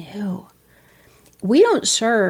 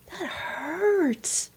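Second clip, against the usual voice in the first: higher, drawn-out lament; softly wailed acted grief; pained mourning; 8 semitones higher, 5 dB quieter, much slower than usual. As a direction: let the hurt swell on the word. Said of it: "the only proper response to a painful thing".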